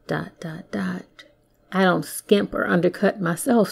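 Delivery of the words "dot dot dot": near-whispered, much softer aside; hushed ellipsis. Pretend. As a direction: drop to a whisper and let it hang. Trailing ellipsis spelt out as aside.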